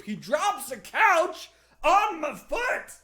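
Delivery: ordinary delivery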